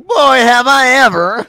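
hammed-up high weird voice, laughing; [name]'s weird voice.

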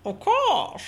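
mock menacing villain line; Bane voice. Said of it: "I didn't put my hand over my mouth, and it felt wrong".

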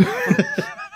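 airy unvoiced chuckle; breathy laugh. Real, not acted. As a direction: let out a quick breathy laugh. Laughing at appointment story.

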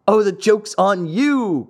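high, drawn-up taunt from a tormentor; jeering cry. A narrator's reading. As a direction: cry it out as a mocking tease, no real laugh. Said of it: "cried his tormentors, laughing more heartily".

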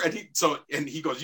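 normal talking voice

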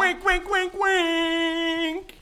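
high, loud sing-song teasing; mock ad sidekick chant; playful mock wink. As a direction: tease it out with a knowing wink. Playful ad echo.

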